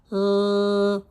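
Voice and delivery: "l" held long on a steady note, "llll"; one continuous "l" sound; stretched-out l. Shown as a demonstration. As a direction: sustain "l" with no vowel. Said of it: "sounds that you can stretch out".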